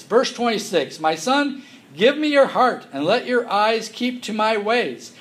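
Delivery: preacher's reading voice; scripture reading. Acted. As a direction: read the verses out to the congregation. Reads Proverbs aloud.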